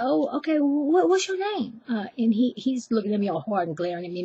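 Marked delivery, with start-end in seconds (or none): 0.0-1.8 high, stammering nervous question. Retold or imitated. Girl asking a glaring pimp, imitated nervous high voice. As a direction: ask timidly in a much higher voice. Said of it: "I was already nervous".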